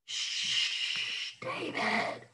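hushed whisper; shushing. Acted. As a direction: shush him quietly. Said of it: "said his big brothers".

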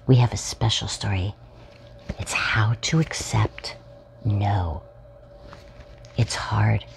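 whispered storytime intro; soft storybook whisper. Acted. Host whispering to young viewers before the story. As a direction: hush it like a secret to children.

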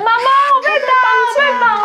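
imitating a crying girl on the phone; fake crying daughter. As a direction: wail it like the sobbing scam call. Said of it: "会有人打到你家哭".

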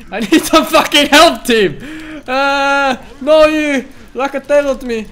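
loud high yell for team; loud call for help. Real, not acted. Calling for help.

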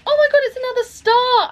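high-pitched delighted squeal of words; excited exclamation. Real, not acted. Vlogger finds another star in her calendar, excited exclamation.

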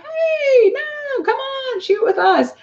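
high, bright-toned friendly invitation; warm and friendly. Retold or imitated. Shooters warmly inviting a newcomer to join, high and friendly. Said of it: "so warm and friendly".

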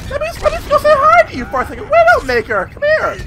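very high teasing hamster voice; squeaky taunt. Pretend. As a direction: tease her in a tiny squeaky voice.

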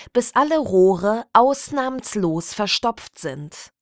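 usual speaking voice

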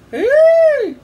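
very high, loud squeal; piercing imitated ape shriek; gorilla shriek. A narrator's reading. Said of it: "she let out a shriek".

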